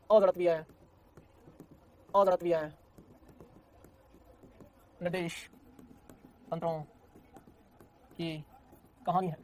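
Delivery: जड़त्वीय निर्देश तंत्रों की कहानी है, each word set apart by long pauses; word-by-word dramatic announcement to the class; dramatic storyteller pause. Shown as a demonstration. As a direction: draw it out like a story title. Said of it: "मैं तुझे feel कराऊंगा बेटा जी".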